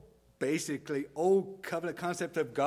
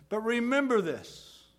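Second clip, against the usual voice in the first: raised, insistent reminder; Jesus; 8 semitones higher, 6 dB louder than usual. Acted. Jesus reminding his sent-out followers, insistent and raised. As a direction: press it home, high and loud. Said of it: "what Jesus is saying".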